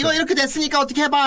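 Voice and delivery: pushy demand. Retold or imitated. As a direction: rattle off a cheeky demand. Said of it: "하잖아, 땅땅이 맨날".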